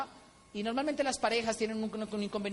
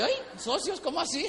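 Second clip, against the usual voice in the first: startled laughing alarm; 8 semitones higher than usual. Retold or imitated. Locked in room, startled.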